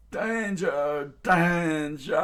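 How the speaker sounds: high, loud, drawn-out alarm cry; loud warning yell, last syllable stretched; alarmed shouting. A narrator's reading. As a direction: sound the alarm at full voice. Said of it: "Pearl continued on, bashing his".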